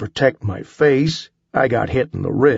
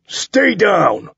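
commanding order; 8 semitones higher than usual. A narrator's reading. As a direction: bark the order down at him. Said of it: "I ordered".

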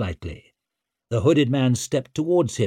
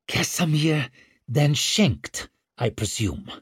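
through gritted teeth; 4 semitones higher than usual. A narrator's reading. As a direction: force it out through clenched teeth. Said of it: "she forced through her gritted teeth".

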